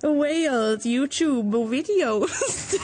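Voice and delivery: slow, badly faked Welsh accent; halting, laughing stab at a Welsh accent. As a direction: attempt Welsh, dragging it out and breaking into giggles.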